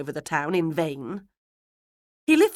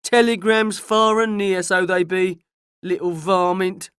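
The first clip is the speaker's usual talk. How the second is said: slow, loud country gossip; Joe; 7 dB louder than usual. A narrator's reading. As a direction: draw it out in a raised rustic voice. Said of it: "said Joe".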